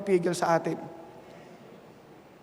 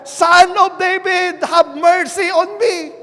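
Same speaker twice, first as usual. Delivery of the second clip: slow, higher, loud crying out; desperate shout; 8 semitones higher, 8 dB louder, slower than usual. Retold or imitated. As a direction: call out with all your strength, slowly. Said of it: "ano po sinigaw niya".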